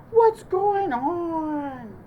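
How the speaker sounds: slow, drawn-out, loud bear voice; puzzled Bear. A narrator's reading. Bear trips into Bunny's home, slow and loud. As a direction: stretch it out, baffled.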